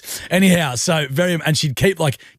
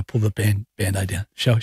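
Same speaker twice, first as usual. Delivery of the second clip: ordinary talk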